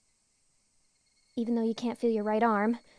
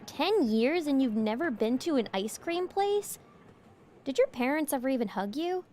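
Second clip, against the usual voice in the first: ordinary talk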